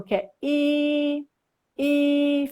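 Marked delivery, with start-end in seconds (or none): 0.4-1.3 "i" held long, high and level; first tone. Shown as a demonstration. Teacher recalling the first tone, "i" long and level. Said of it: "eu falei do primeiro". 1.8-2.5 "i" stretched on a high, level pitch; first tone. Shown as a demonstration. Teacher showing how it stays, "i" high and level. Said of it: "fica assim".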